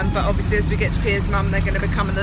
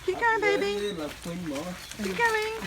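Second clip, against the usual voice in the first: encouraging; 12 semitones higher, 7 dB quieter than usual. Real, not acted. Encouraging toddler.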